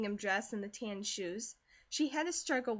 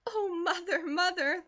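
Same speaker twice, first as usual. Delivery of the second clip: laughing with half sob; 8 semitones higher, 5 dB louder than usual. A narrator's reading. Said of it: "laughed Elnora, with a half sob in her voice".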